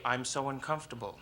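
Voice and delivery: low, soft, controlled; composed labor phrase. Retold or imitated. Mother coached to breathe through labor, calm understatement. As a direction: say it softly as breathing through pain. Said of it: "supposed to breathe through it and say".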